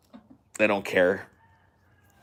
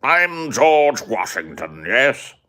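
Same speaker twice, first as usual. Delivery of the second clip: loud, high, drawn-out mock British accent; George Washington; 6 semitones higher, 6 dB louder, much slower than usual. Imagining a founding father introducing himself, hammed-up British accent. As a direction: put on a slow, hammed-up British voice. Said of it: "our founding fathers spoke like this".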